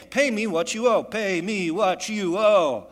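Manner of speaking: loud, high, slowing furious demand; strangling demand. Acted. Creditor choking his fellow servant, loud furious demand. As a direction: bark it while throttling him. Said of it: "and begins saying".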